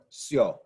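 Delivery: "sio" as one syllable, "syo"; blended vowels. Shown as a demonstration. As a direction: glide I straight into O in "sio". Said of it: "Blends together".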